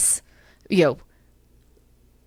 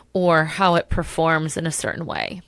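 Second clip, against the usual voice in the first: ordinary delivery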